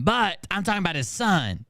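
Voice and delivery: ordinary talk, nothing marked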